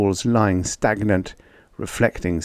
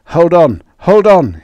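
loud, high, urgent command; strained shout, rising in urgency; gritted; 9 semitones higher, 10 dB louder than usual. A narrator's reading. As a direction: urge him to hang on through gritted teeth. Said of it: "he gritted".

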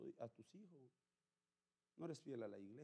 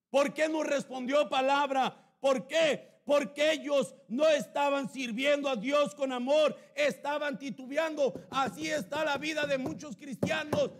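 high, loud, slow preaching voice; fiery preaching; 13 semitones higher, 22 dB louder, slower than usual. Acted. Pastor preaching Elijah to the church, at full force. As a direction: thunder the scripture at them.

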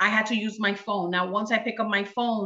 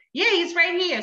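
put-on high child voice; son on phone; 9 semitones higher than usual. Son answers mom about his father, high child voice. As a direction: imitate a boy answering his mother. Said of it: "He goes".

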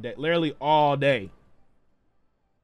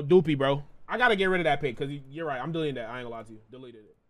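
ordinary delivery

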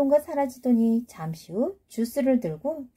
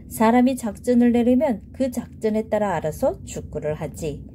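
ordinary delivery

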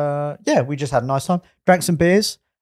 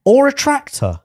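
high, playful quip; excited; 14 semitones higher, 4 dB louder than usual. Real, not acted. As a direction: toss it out with glee. Jokey high exclamation.

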